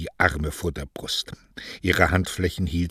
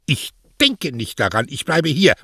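raised, louder, stubborn retort; defiant refusal; 8 semitones higher, 6 dB louder than usual. Acted. Daughter refuses her mother's order to pack, defiantly. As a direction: dig in and refuse, voice lifted.

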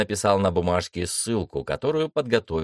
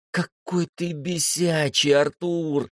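weary exasperation; 10 semitones higher, slower than usual. A narrator's reading. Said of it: "устало вздохнула Света".

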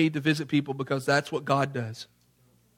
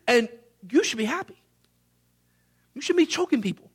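high-pitched indignant scolding, not shouted; acted indignant anger; 8 semitones higher than usual. Furious master to the servant he freed, indignant rebuke. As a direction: scold in a strained high voice, holding back volume. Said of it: "he is really mad".

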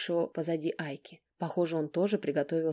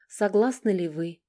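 ordinary delivery, nothing marked

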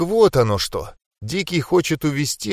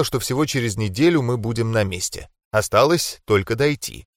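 ordinary delivery, nothing marked

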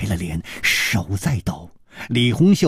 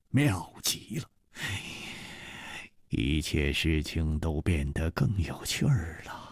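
soft, musing undertone; murmuring to himself; 6 semitones lower, 11 dB quieter than usual. A narrator's reading. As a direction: muse it quietly, for nobody else. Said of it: "他喃喃地说".

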